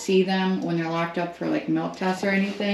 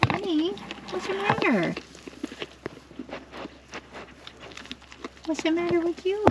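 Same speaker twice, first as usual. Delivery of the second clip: much higher, softer coaxing voice; 11 semitones higher, 6 dB quieter than usual. Real, not acted. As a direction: coax the nervous goat tenderly. Coaxing skittish goat.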